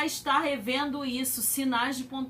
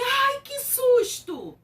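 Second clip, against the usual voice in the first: much higher, louder, slow gasp; startled exclamation; 11 semitones higher, 6 dB louder, slower than usual. Shown as a demonstration. Teacher showing a startled exclamation, high and louder. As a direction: jump with fright. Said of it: "Ou então assim".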